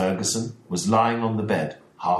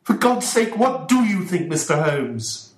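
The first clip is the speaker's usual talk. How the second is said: desperate cry, much higher and louder; shouted; 11 semitones higher, 5 dB louder than usual. Acted. Ferguson begging Holmes for an answer, a desperate shout. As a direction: demand an answer in anguish. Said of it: "shouted Mister Ferguson".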